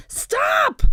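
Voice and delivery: very high, loud yell; yelled command. A narrator's reading. Captain chasing his men down the beach, yelling. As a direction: yell after runaways.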